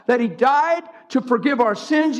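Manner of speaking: usual speaking voice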